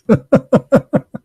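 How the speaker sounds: high burst of real laughter. Real, not acted. Voter laughing at the poll lady's reaction, high real laugh. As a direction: laugh hard at your own punchline.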